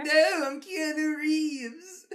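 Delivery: high, slow squeaky voice, laughing; mock the stalker's silly high voice; squeaky villain voice. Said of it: "Would Keanu Reeves sound like that".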